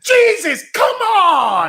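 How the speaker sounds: high, loud exasperated wail; mock exasperation. Comic voicing host's inner thoughts at producer, exasperated high cry. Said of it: "inside you're really going".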